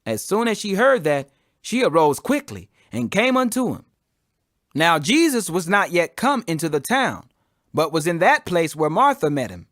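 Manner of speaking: ordinary delivery, nothing marked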